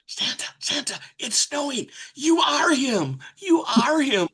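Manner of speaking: high tearful shout of joy; a child near tears, yelling through the glass; crying out excitedly. Retold or imitated. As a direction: kids tapping on the mall window, crying out. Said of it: "And she starts crying".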